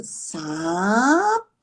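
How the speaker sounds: "sapo" dragged out very slowly, each sound held; stretched word. Shown as a demonstration. Therapist demonstrating prolonged speech, "sapo" stretched very slowly. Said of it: "Mas também pode ser assim".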